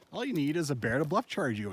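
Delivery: usual speaking voice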